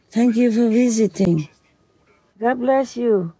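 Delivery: tender pet talk. Real, not acted.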